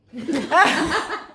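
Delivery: loud, high burst of laughter; big laugh. Real, not acted. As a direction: let a full laugh out. Laughs at the kennel joke.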